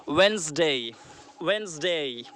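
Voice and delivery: Wednesday said slowly as "WENZ-day", the middle d silent; correct pronunciation. Shown as a demonstration. Teacher drilling students on the right sound, Wednesday as "Wenzday". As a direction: say Wednesday like "Wenzday", slow and clear. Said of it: "हमें सही पढ़ना चाहिए".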